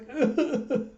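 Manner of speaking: high burst of laughter; laughing. Real, not acted. Storyteller loses it after George's confession, laughing. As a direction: crack up at the melodrama.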